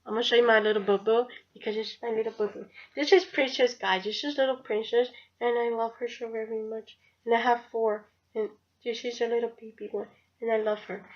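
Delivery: soft, cutesy cooing with mushy consonants. Real, not acted. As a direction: coo softly over your little darling. Cooing while showing off her pet.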